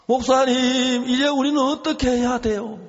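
imitated wail at the top of the voice; retold wailing, stronger. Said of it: "갑자기 사모님 막 통곡을 하기 시작하는 거예요".

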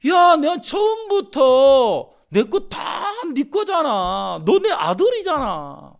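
emphatic father voice, drawn out; exclaiming father. Acted. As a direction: insist with open arms.

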